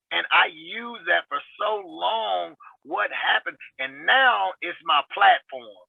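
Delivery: ordinary talk, nothing marked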